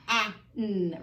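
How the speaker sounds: "a, n" as short A, pause, humming N; separate sounds. Shown as a demonstration. Teacher shows why it's a glued sound, separated. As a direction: pull "a, n" apart into two sounds. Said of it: "it's really, really hard to say".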